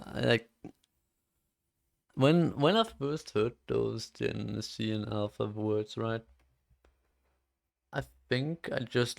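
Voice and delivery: ordinary talk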